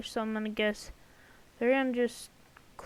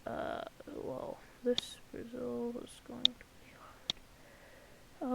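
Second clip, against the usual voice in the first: slow whisper, thinking aloud; whispering to himself; 11 dB quieter, slower than usual. Real, not acted. Working on the map, whispering to himself. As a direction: murmur it slowly under your breath.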